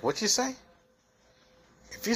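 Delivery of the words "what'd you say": mock challenge. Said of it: "will she be like".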